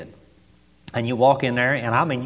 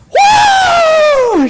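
piercing high "woo" scream; 14 dB louder than usual. Retold or imitated. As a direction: imitate a sudden joyful church scream, full out. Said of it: "Just scream".

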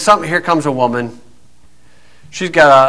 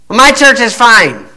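high, slow mock-defensive voice; defensive woman; 5 semitones higher, slower than usual. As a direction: mimic a huffy churchgoer, drawing it out. Mimics the woman's defensive answer.

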